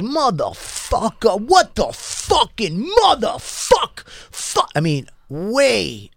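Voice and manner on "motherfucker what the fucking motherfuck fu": high, slow mock rage at a stranger; imitating the guy cursing him out, pitch way up; retold cursing. Guy in the subway cursing at him, high mock fury. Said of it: "And he goes".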